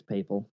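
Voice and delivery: "papal" with a long A, first syllable like pay; long-A pronunciation. Shown as a demonstration. Co-host offering the Doctor Who pronunciation, "papal" with long A. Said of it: "That's how they say it on that".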